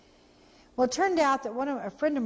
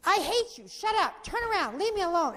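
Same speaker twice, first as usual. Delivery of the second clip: higher, mock snarling teenager; mock bratty teen; 9 semitones higher than usual. Bitter teen shouting at the caring woman in the car. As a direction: throw it out like a spoiled girl. Girl's insults retold, high.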